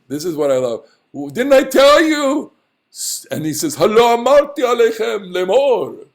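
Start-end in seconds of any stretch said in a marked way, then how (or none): 1.1-2.5 high, loud pompous proclamation; lofty, stretched-out grandeur; grandiose. Acted. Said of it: "he's saying it in such grandeur". 3.8-6.1 high, pompous Hebrew declamation; puffed-up, sonorous grandeur; grandiose. Acted. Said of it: "he's saying it in such grandeur".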